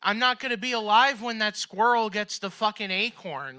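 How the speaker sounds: loud, high, worked-up rant; excited outburst. Real, not acted. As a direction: blurt it out with worked-up energy. Comic outburst about the squirrel.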